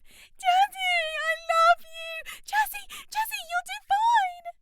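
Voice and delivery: high, loud, slow adoring screams; fan cries. A narrator's reading. Fans calling to the singer, adoring high loud screams. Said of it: "the cries of".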